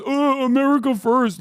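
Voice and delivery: high, loud mocking voice of a MAGA fan; mocking quote. Streamer mocking a chat troll, high and loud. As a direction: sneer it out like a dumb fan. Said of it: "your dumb ass turns around and goes".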